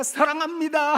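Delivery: high, loud I love you; excited I love you. Retold or imitated. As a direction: call out the love to an old father. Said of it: "제가 … 하면". (아버지께 높고 크게 외치는 사랑합니다)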